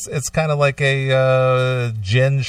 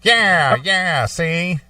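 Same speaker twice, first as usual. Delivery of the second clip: fifties gangster voice; 7 semitones higher, much slower than usual. Acted. Gangster impression.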